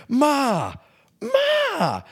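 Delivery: high, drawn-out mock Jersey yell; Jersey accent. Man locked out of mum's car, high Jersey-accented call. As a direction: imitate a Jersey guy calling his mum. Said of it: "that's my Jersey accent".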